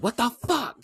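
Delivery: high-pitched mock frustration; frustrated exclamation. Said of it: "They're getting frustrated. Max is like".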